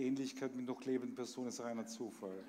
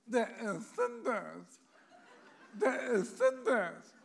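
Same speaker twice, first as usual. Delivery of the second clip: squawky parrot mimicry, higher and slowed; parroting bird voice; 11 semitones higher, 9 dB louder, much slower than usual. Parrot in the poem repeating its owner, squawky mimicry. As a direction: imitate a parrot repeating the lady. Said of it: "lernt dabei sonst nichts zum Reden".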